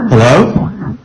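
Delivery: loud, high wary call. Real, not acted. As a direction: shout out into the house, spooked. Calling out after eerie voice.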